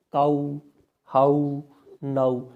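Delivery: काऊ, हाउ, नाउ said slowly, each vowel glided out; original pronunciation. Shown as a demonstration. Teacher modelling काऊ, हाउ, नाउ for trainee teachers. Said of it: "ये इसके original pronunciation है".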